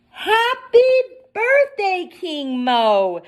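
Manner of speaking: high, loud birthday cheer; yelled. A narrator's reading. Humpty yelling happy birthday down to King Mo, high and loud. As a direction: call out the greeting with glee. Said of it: "Humpty started to yell".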